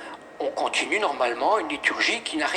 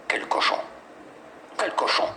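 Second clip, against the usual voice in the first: whispered demon taunt. Retold or imitated. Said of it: "demon mocks the greedy priest".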